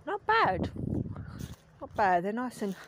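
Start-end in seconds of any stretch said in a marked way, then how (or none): 0.1-0.7 high, drawn-out "not bad" of surprise; surprised delight at a berry; pleasantly surprised. Real, not acted. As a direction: squeak "bad" up high as the taste lands. Tasting a wild blackberry.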